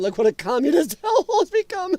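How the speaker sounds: high, laugh-filled voice; laughing while reading. Real, not acted. Reading a chat comment on California, laughing. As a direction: break into real laughter as you read.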